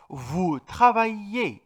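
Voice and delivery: travaillez said slowly with ail as ay; teacher modelling the French verb; French pronunciation demo. Shown as a demonstration. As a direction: stretch out travaillez so ail sounds like ay. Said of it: "A y L se pronuncia ay".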